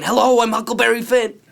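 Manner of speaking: put-on high, very loud boyish holler; big high-pitched boy's announcement; little boys calling. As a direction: shout it out like an exuberant little boy. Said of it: "little boys saying".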